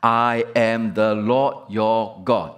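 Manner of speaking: slow, deliberate refrain with stress on Lord; slow solemn proclamation; emphatic refrain. Shown as a demonstration. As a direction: spell it out word by word, leaning on Lord. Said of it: "Notice the refrain now".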